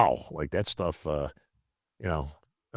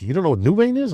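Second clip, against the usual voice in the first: higher-pitched mock disbelief; retold incredulous question; 8 semitones higher than usual. Gym friend to newcomer who doesn't know, mock incredulous. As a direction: ask it as an astonished friend, retold. Retold surprise at his ignorance.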